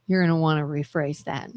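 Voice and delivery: low, quiet, quick mock threat; warning. Retelling how she cautions her friend, quietly warning. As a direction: drop low and warn them off. Acts out warning the other speaker.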